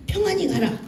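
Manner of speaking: quoting Eli. Retold or imitated. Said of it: "그러니까 뭐라 그러냐".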